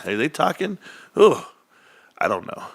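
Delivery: ordinary delivery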